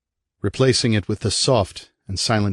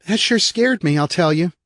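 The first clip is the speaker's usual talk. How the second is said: put-on high, bright-eyed kid whooping then chatting; little brother bragging about his fall; cheerful little boy; 11 semitones higher, 4 dB louder than usual. Eric voiced as a boy.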